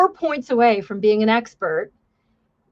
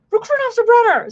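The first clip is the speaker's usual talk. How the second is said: much higher, drill-instructor bark; yelling instructor; 12 semitones higher, 5 dB louder, faster than usual. Retold or imitated. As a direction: call the recruit out like a drill instructor. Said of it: "the instructors yell at Contreras".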